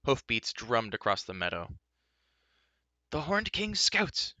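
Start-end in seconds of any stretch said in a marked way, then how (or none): 3.1-4.3 high, alarmed cry. A narrator's reading. Bard crying warning to companions, high. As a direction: shout the warning. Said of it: "cried Fluter".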